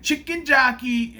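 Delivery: high, loud, drawn-out call; imitated catchphrase shout; mock audience yell. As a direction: yell it out like a rowdy moviegoer. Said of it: "They kept yelling out stuff".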